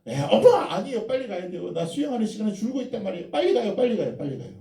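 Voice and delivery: put-on high whiny child hurrying mom along; pushy child. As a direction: beg over and over like a restless kid. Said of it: "재촉하죠". (높은 목소리로 엄마를 재촉하는 아이)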